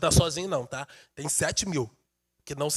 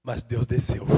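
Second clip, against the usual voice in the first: soft intimate preaching; 8 semitones lower, 5 dB quieter than usual. Acted. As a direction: draw them in gently.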